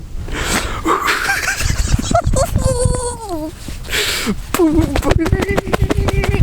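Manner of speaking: long high giddy laugh. Real, not acted. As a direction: laugh out the nerves. Overjoyed after the kill.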